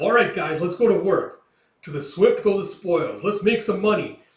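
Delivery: loud, high rousing call; rallying shout. Acted. Gambino rallying his mercenaries at the gates, loud rallying shout. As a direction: fire up the mercenaries.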